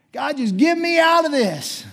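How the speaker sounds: high, loud plea to God; desperate cry. Acted. Pastor acting out our heart's cry to God, desperate. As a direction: cry out as if begging for escape. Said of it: "what is our heart's cry?".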